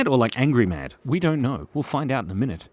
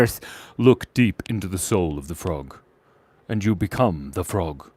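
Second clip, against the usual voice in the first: ominous low voice; 7 semitones lower, 3 dB quieter than usual. Acted. Mystic command to become frog.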